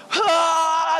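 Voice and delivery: loud, high "aah" scream; retold scream. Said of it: "I'm screaming".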